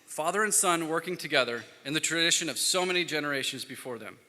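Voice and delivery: ordinary talk, nothing marked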